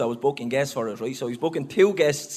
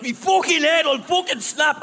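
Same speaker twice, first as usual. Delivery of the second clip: higher, louder mock rage; imitating a fuming mate; 10 semitones higher, 4 dB louder than usual. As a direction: act out the friend losing his temper after banging his head. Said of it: "and he was fuming".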